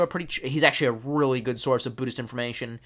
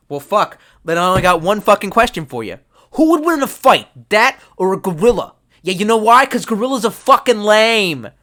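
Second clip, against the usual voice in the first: loud, higher, brash bar-room challenge; loud mock Boston shout; 5 semitones higher, 8 dB louder than usual. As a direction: bellow it like a rowdy drunk. Loud drunken quote of a stranger.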